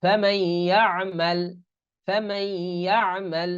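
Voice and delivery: slow recited ফামান ইয়ামাল, as famayy-ya'mal; idgham into ya. Shown as a demonstration. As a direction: stretch a nasal y from ফামান into ইয়ামাল. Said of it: "এরকম উচ্চারণ হবে".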